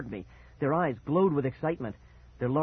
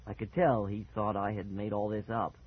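plain reading voice

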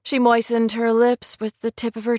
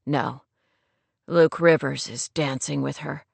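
male lead's reply; 7 semitones lower than usual. Acted. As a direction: lower voice for the man. Narrator voices the man's reply.

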